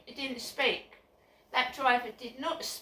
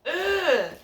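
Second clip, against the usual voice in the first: loud exasperated ugh; frustrated groan; 5 semitones higher, 10 dB louder than usual. Real, not acted. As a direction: let out a fed-up groan. Frustrated outburst opening vlog.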